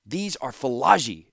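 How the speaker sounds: shout. A narrator's reading. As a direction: shout it down at her, slowly. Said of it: "shouted Tanos".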